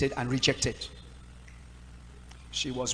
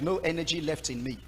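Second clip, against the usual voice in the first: ordinary talk, nothing marked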